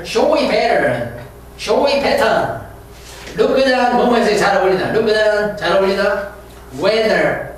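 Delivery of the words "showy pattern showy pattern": "showy pattern" said very slowly, word by word; slow teacher drill. Shown as a demonstration. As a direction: spell it out slowly for students. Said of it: "slow vocabulary drill".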